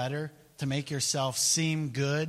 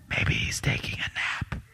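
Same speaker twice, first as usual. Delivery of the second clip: teasing stage whisper; mock whisper. Elijah whispering mockery to Baal's prophets, hushed. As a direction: lean in and whisper the joke.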